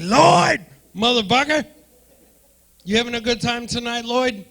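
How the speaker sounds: loud, high, fast hammed-up yell at Lloyd; mock angry shout. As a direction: bark it out like an irritated heckler doing a bit. Comic imitates angry audience member.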